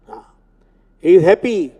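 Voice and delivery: usual speaking voice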